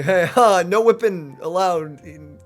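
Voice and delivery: usual speaking voice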